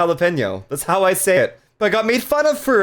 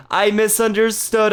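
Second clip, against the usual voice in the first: ordinary talk, nothing marked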